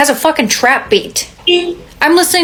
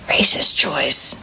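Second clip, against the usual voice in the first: whisper; 8 semitones lower, 6 dB quieter, much slower than usual. Acted. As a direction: drop to a hushed, slow whisper. Dramatic whispered punchline.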